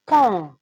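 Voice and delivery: "pan" said loud and crisp as a separate syllable; syllabe détachée. Shown as a demonstration. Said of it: "C'est re". ("pan" prononcé fort, syllabe bien détachée)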